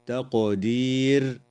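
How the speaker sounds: تقدير with a bouncing echo on the ق; echoing qaf in تقدير; qalqala on qaf. Shown as a demonstration. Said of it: "تقلقل القاف".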